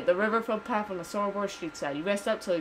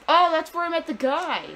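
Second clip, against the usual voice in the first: higher, louder burst of recognition; excited recognition; 8 semitones higher, 6 dB louder than usual. Real, not acted. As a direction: exclaim as it suddenly clicks. Recognizes a place while playing.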